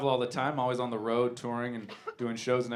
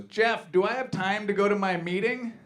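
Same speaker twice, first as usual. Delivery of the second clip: put-on raised pitch, out-of-nowhere elderly question; old lady suddenly asking, pitched up; imitating grandma; 6 semitones higher than usual. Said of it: "she just looks at me and goes".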